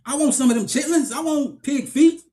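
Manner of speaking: high, hammed-up movie character voice; movie-line impression. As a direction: act out a favourite movie rant, pitched high. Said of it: "what's your favorite line from Friday".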